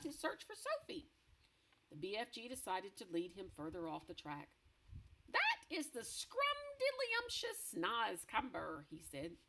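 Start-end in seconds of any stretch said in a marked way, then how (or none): 5.3-8.9 high, slow, savouring giant; BFG voice. Acted. BFG praising the Snozcumber to Blood Bottler, high and slow. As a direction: draw out the delicious word slowly and high.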